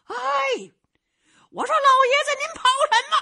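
high, loud hail to a man far ahead; young fighter hollering after the running old swordsman; calling out. Acted.

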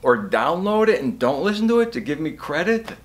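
normal talking voice

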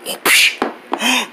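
ordinary talk, nothing marked

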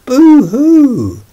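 very high, loud hammed-up boo-hoo; mock sobbing wail. Girls and Pepito crying out boo-hoo in mock fear. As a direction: wail like frightened crying children. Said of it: "The girls and Pepito cried".

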